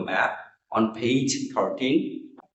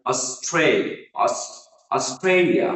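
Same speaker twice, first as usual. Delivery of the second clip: Australia with a long, stressed middle "STRAY"; stress on second syllable; 3 semitones higher, slower than usual. Shown as a demonstration. Teacher showing learners how to pronounce Australia, second-syllable stress. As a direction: punch the middle of Australia, slowly. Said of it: "the second syllable is stress".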